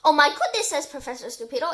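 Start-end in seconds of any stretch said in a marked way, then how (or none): 0.0-0.7 loud startled exclamation; shocked. A narrator's reading. Professor just missed by a bowling ball, shocked. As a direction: exclaim in alarm.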